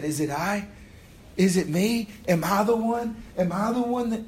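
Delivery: climbing panic, one question after another; anxious disciple. Acted. Preacher voicing disciples asking Jesus, rising anxious pleading.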